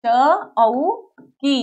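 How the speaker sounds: Chowki stretched very slowly; drawn-out word. Shown as a demonstration. Teacher stretching Chowki so children can hear each sound. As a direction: draw out Chowki for the class to hear.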